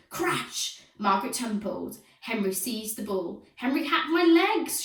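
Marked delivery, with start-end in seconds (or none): 3.6-4.8 high, loud shriek; high, loud complaining shriek. A narrator's reading. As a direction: shriek the accusation. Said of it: "shrieked Margaret".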